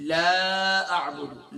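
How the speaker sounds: لا drawn out long and slow. Shown as a demonstration. Teacher stretching لا for the student. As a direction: stretch the alif of لا fully. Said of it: "لا کے معنی نفی کے ہیں".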